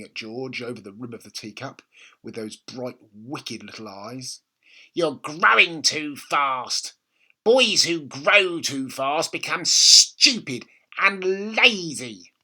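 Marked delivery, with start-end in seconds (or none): 4.9-12.3 put-on slow, higher, louder old woman's command; bossy grandma. A narrator's reading. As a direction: lay down the rule slowly. Grandma voiced in read story.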